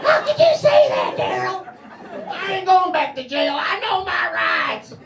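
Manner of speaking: high, loud, slow protest; shouting suspect. Pretend. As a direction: yell your innocence at the cops.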